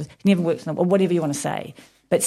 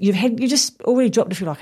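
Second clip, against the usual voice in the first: ordinary talk, nothing marked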